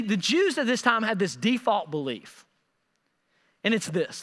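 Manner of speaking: usual speaking voice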